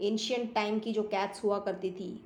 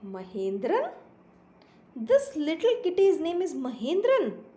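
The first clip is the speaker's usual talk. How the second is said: slow, lilting storybook reading; story read-aloud; 3 semitones higher, 4 dB louder, much slower than usual. A narrator's reading. Teacher reading a kitten story to pupils, slow expressive narration. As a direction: read slowly with emphatic pitch swoops on key words.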